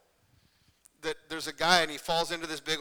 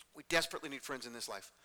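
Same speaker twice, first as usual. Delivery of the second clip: softer, lower, sober statement; hushed earnestness; 4 semitones lower, 7 dB quieter than usual. Real, not acted. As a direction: drop the voice and say it earnestly. Repeats statement more softly for weight.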